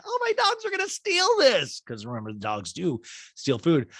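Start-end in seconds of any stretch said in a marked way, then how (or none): 0.0-1.8 much higher, squeaky repeat; high fretting Kyle. Retold or imitated. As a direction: fret in a squeaky voice.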